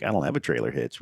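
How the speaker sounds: flirtatious. Retold or imitated. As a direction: drop low and coy, as if winking at him. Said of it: "this flirtatious wink".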